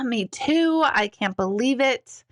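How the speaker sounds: ordinary talk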